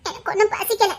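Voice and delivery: soft, sulky refusal of food. Acted. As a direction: pout and mumble she isn't hungry. Sulking wife refuses food. (முணுமுணுத்து மறுப்பு)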